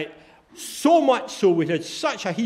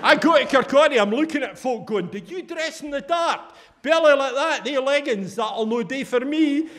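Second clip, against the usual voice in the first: 1 mock snooty woman; 9 semitones higher, 4 dB louder than usual. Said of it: "she said".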